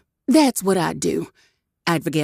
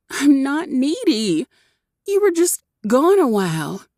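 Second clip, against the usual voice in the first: defensive protest; 9 semitones higher than usual. A narrator's reading. Cherry denies being needy to Dante, high and flustered. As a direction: push back, flustered.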